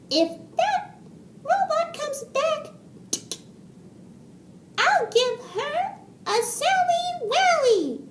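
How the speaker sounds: very high, squeaky mouse voice; plucky little mouse. A narrator's reading. Mouse plans to face the robot, squeaky bravado. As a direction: squeak out a brave little vow.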